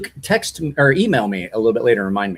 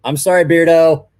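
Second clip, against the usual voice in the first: usual speaking voice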